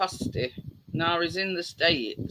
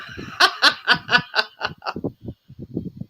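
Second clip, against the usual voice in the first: breathy chuckle; laughing; 4 semitones higher, 3 dB louder than usual. Real, not acted. Host chuckling at his own joke to viewers.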